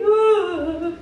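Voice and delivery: loud, high, hammed-up wail; exaggerated sobbing complaint to a friend; mock crying. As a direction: bawl your troubles out for pity. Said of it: "by crying".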